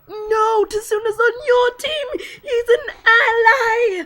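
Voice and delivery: high, loud, half-crying, half-laughing protest; panicked plea. Retold or imitated. Fan begging Colonello, frantic shout. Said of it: "And I was like".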